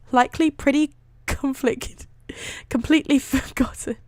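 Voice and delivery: trying to read through giggles; words broken up by real laughter; laughing. Real, not acted. Reader cracking up over the story, laughing. Reader breaks up while reading.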